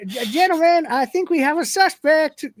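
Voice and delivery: high, hesitant polite voice; a meek man calling after the others; timid high voice. Acted. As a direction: squeak it out nervously. Dwayne voiced by reader.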